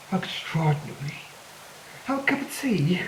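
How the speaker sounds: soft, whispery fake British accent; mock British accent. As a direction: badly imitate genteel British speech, softly. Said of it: "the way British people talk".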